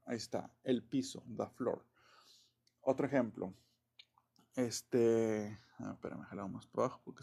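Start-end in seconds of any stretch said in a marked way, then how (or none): 1.4-1.9 "the floor" with "the" as "da"; "the" before consonant. Shown as a demonstration. Teacher gives an example, "the floor" said "da floor". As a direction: say "the floor" as "da floor", tapped r. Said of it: "Sería como the".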